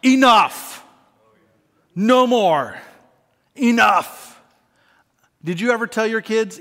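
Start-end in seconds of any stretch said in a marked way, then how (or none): 0.0-4.4 put-on loud, high scolding of fighting boys; parent scolding. Parent scolding two boys arguing in the back seat, loud stern scolding. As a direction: shut the boys down hard. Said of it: "And you're like".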